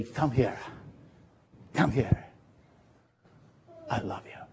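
soft, fading to a whispered I love you; God the big mama calling softly; tender call. Acted. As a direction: draw them in with a hush. God as loving mother calling.

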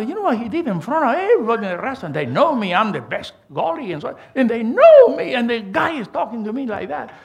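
fast, rising outrage that peaks loud; indignant complaint. Retold or imitated. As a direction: pour out the hurt to mother. Complaining to his mother afterward.

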